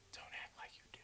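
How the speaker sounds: barely audible whisper; hushed, voiceless aside. Real, not acted. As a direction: whisper it teasingly to the audience. Teasing viewers, repeated as whisper.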